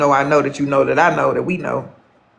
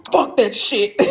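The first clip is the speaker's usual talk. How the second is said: higher mock brush-off; a higher, lightly imitated "fuck that shit"; imitating him; 7 semitones higher, slower than usual. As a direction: toss it off like he did. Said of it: "he was like".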